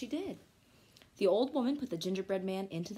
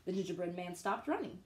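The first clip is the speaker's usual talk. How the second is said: plain reading voice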